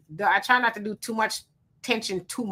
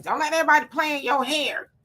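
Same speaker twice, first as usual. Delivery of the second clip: lightly acted warning, pitched up; childhood warning; 7 semitones higher than usual. Repeating what kids were told about their hair, mock warning. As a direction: imitate an elder cautioning a child, higher voice. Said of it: "We were told that as, um, kids".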